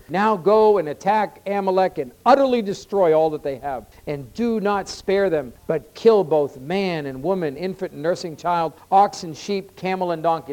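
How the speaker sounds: loud, measured proclamation of a command; forceful scripture reading. Real, not acted. Preacher reads God's command to attack Amalek, forceful scripture reading. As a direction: declaim each item of the verse with force.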